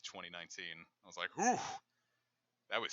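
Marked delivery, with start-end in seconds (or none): 1.4-1.6 high, loud exhale of relief; relieved whew. Retold or imitated. Recalling the day they finally split, relieved whew. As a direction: let out a big "whew". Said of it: "one of the happiest days".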